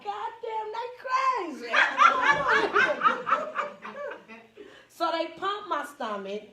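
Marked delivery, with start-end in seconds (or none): 0.0-1.7 high mock amazement; amazed. Patient about others, high amazed. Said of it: "you be like". 1.8-4.7 high loud cackle; loud laugh. Real, not acted. Storyteller to audience, laughing loudly.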